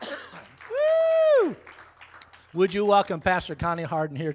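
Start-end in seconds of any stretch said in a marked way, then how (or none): 0.0-1.6 high sudden woo; a relieved whoop to recover. Real, not acted. Recovering after choking up.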